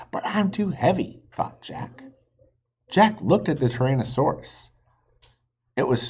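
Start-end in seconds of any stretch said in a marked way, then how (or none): 0.1-1.2 put-on high, fretting kid voice; worried boy. Jack facing the flying reptile, worried. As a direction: fret about it like a nervous boy.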